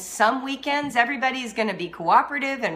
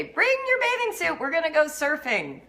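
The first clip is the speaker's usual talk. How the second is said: excited message; 7 semitones higher than usual. Retold or imitated. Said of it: "She just sent me a message".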